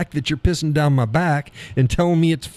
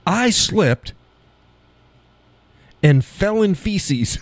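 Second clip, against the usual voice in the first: high-pitched, deliberate phrasing with FECES drawn out; awkwardly formal demo; 7 semitones higher, much slower than usual. Shown as a demonstration. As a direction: show how clumsy "feces" sounds, pitched high and slow. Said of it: "doesn't even roll off the tongue".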